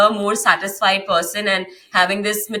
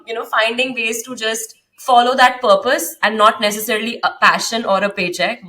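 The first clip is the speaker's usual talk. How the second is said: usual speaking voice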